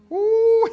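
a very high, loud ooh; preacher's high loud ooh. Real, not acted. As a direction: whoop it out in delight. Preacher exclaiming.

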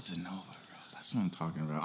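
hushed whisper. Real, not acted. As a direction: drop to a breathy whisper. Quiet hype shout-out.

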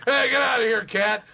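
mock shooing. Acts shooing cat before guests.

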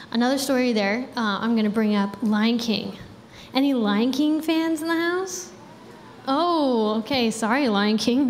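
normal talking voice

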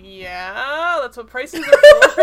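hammed-up sarcastic retort breaking into laughter. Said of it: "He's not this sarcastic".

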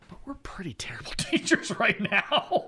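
high, squeezed laughing voice; laughing admission. Real, not acted. Student teacher joking with peers about being bad, laughing. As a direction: break into laughter while confessing.

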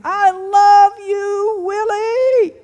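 high, drawn-out holler to a stage; yelling fan. Retold or imitated. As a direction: imitate a rowdy concertgoer yelling, stretched out and high. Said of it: "She's yelling".

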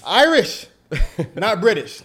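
loud, high, playful Irish, not British; emphatic correction. Real, not acted. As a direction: shout the fix with a grin. Playfully correcting his mistake.

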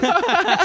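squealing laugh at his own joke; high burst of laughter. Real, not acted. Friend cracking up at his own bike-theft joke, high laughter.

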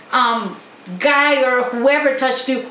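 ordinary delivery

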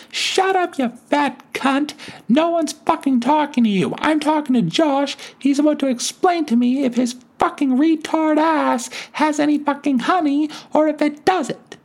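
very high furious granny voice; old lady screaming at the store manager; screeching old lady rant. Retold or imitated. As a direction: shriek down the manager like a feral granny.

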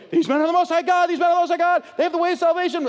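possessed woman crying after Paul, high and loud. Retold or imitated. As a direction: cry it after him over and over like a possessed woman. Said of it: "this woman going".

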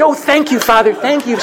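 very high, gushing thanks; thrilled pious woman. Retold or imitated. Delighted woman thanking the seminarian, very high and gushing. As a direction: squeal your gratitude up high. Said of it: "she's thrilled".

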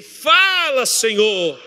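high, loud cry on "Fala", falling on "Senhor"; full-voiced plea to God; forceful call. Shown as a demonstration. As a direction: lead the congregation in a fervent shout. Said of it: "De novo assim".

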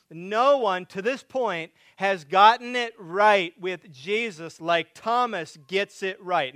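loud, high, building sermon climax; preaching at full force. Acted. Pastor to congregation, preaching at full force. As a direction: preach it out with rising fervor to the congregation.

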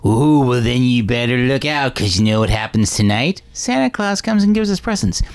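put-on slow, sinister old man; evil grandpa warning. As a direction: drawl the threat out to terrify the boy. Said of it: "just the evilest grandpa ever".

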